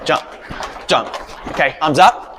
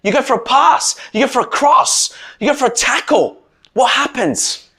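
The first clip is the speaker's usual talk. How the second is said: animated pep talk; 7 semitones higher than usual. Real, not acted. Coach firing up tackle talk.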